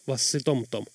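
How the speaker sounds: ordinary delivery, nothing marked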